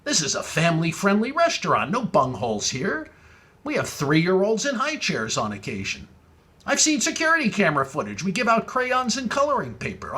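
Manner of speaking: mock indignation. Host scolding the rude caller, mock outrage. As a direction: scold him in playful outrage.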